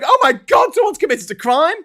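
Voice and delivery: high, loud hammed-up panic; mock horror. Killer standing over the body, feigning horror. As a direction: cry out in fake shock, as if innocent. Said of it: "standing over the body going".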